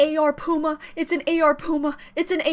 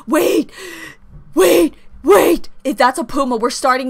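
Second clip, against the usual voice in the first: excited; 7 dB louder than usual. Real, not acted. Realising the song.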